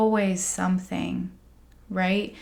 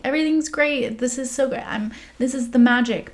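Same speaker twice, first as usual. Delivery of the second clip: mock bright, much higher cheerful front; fake cheer; 5 semitones higher than usual. Pretending to friends all is great, mock-cheery. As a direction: put on a sunny everything-is-fine act. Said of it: "I always wanna put on".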